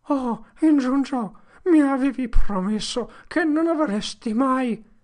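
high, loud desperate appeal; anguished exclamation. Acted. Potter appealing to Injun Joe, high and anguished. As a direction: cry out in betrayal. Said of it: "esclamò".

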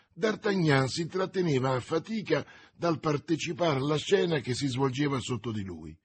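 usual speaking voice

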